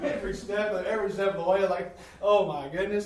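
half-laugh the memory of each step. Real, not acted. Telling the congregation about the climb, laughing.

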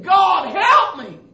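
high, loud, drawn-out cry to God; retold scream. Man circling in darkness screams to God, retold desperate cry. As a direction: re-enact a desperate scream for help. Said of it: "until I started screaming".

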